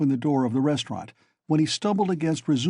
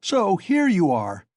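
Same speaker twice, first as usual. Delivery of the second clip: slow, loud, higher angry shout; furious friend finding the runaway patient; shouting at the top of his voice; 6 semitones higher, 4 dB louder, slower than usual. Acted. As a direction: bellow it at him. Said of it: "he shouted at the top of his voice".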